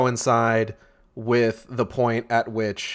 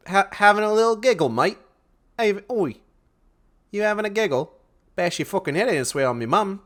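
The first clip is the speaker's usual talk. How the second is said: higher, aggressive Aussie eshay voice; hammed-up eshay lad threatening; eshay slang; 7 semitones higher, faster than usual. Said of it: "That's what he says".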